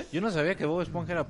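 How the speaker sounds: laughing high and loud. Real, not acted. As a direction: laugh through the line. Laughing at friend's confession.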